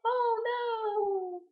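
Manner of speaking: high, put-on squeal of fright; mock panic. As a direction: ham up a victim's cry. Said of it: "she's like".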